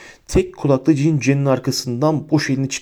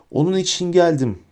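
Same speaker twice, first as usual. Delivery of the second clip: understated shout, pitched up; 7 semitones higher than usual. A narrator's reading. Harry answers Bellatrix in the duel, mildly shouted. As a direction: raise the voice high without much volume. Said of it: "diye bağırdı Harry".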